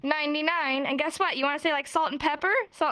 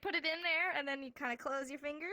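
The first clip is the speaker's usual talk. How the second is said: ordinary talk, nothing marked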